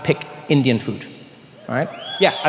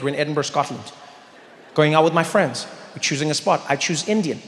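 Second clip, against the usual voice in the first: normal talking voice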